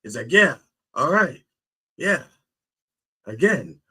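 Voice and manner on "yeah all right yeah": high, loud cheer; delighted acted-out whoop; happy. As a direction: celebrate in a raised voice. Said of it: "He's so happy".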